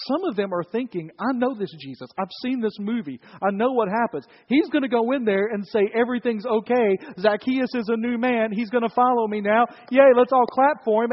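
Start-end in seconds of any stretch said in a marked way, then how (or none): 1.2-11.1 higher, building louder mock cheer; mock the happy ending; sarcastic cynic. Cheated townsfolk scoffing at Zacchaeus's conversion, sarcastic and rising. Cheated people mocking the scene.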